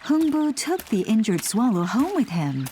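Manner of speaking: usual speaking voice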